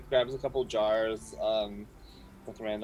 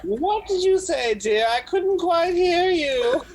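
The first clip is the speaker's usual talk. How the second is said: put-on loud old lady; 11 dB louder than usual. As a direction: shout like she can't hear. Hard-of-hearing old woman.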